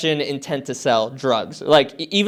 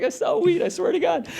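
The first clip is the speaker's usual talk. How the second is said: high, softer retold plea; pleading; 4 dB quieter than usual. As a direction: swear it to the cops in a high voice. Pleading innocence to police, retold.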